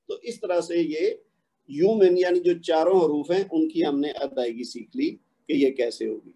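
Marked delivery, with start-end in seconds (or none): none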